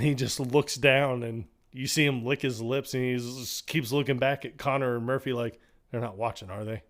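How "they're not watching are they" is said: sneaky hushed aside. Retold or imitated. Rocco sneaking a look.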